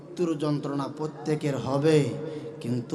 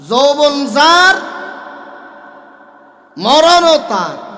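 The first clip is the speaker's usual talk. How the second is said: higher, louder, slow fiery sermon; preaching at full force; 10 semitones higher, 12 dB louder, much slower than usual. Acted. Preacher rousing the congregation, loud and slow. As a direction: hammer it home to the crowd.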